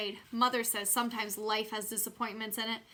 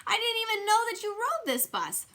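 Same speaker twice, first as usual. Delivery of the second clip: surprised; 12 semitones higher, 5 dB louder than usual. A narrator's reading. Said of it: "real surprised".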